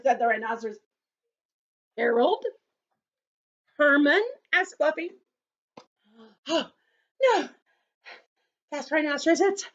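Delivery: put-on gentle storybook narration for kids; children's story reading. A narrator's reading. Teacher reading a story to children, gently. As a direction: read it to the circle like a picture book. Reads picture book to children.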